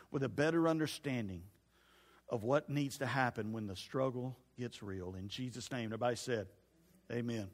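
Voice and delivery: a gentle, hushed pastor's prayer. Acted. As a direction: pray over the congregation quietly.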